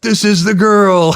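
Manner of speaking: high, loud cheering approval; excited approval. Retold or imitated. Platoon buddies cheering the new wife, loud and high. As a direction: hail her as the one, laughing.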